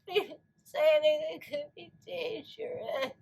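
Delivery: usual speaking voice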